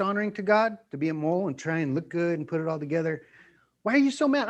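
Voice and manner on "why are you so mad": accuser. Retold or imitated. Acted quarrel, accuser.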